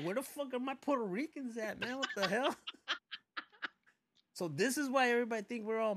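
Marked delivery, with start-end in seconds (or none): 0.0-2.5 high put-on voice, half shouting; lightly voiced confused Puerto Rican; mock lost tourist. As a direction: play the confused visitor. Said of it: "But then I'll be like".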